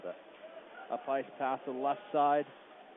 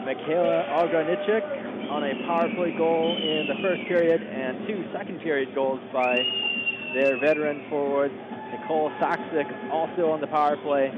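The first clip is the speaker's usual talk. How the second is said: sports announcer; 9 dB louder than usual. Real, not acted. Live sports score update.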